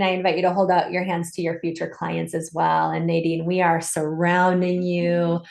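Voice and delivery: normal talking voice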